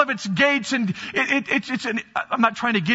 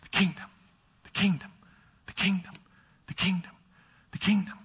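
soft refrain; 6 dB quieter than usual. Real, not acted.

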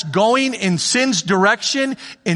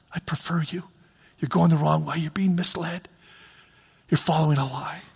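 hushed, intimate "I prefer you"; whispered God; 4 semitones lower, 6 dB quieter than usual. Acted. God warning the sinner in a whisper. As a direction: whisper it like a secret plea.

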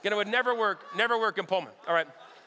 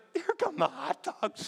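higher, slow laughing call of a thrilled neighbour; excited announcement; 7 semitones higher, slower than usual. Retold or imitated. Tara recalling the neighbour spotting hot dogs, slow high laughing call. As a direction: call it out like the best news of the day. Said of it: "Tara was like".

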